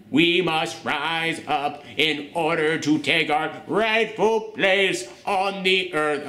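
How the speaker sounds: slow, shaky mock-villain voice; Maximus. Mocking the actor's speech about rising up, shaky and drawn out. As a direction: draw it out with an exaggerated wobble. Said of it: "like, shaky voice all throughout".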